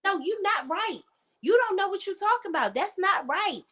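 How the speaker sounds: high mock scolding; imitating Tiny. Tiny correcting Tamar, high mocking voice. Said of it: "I used to hear her say".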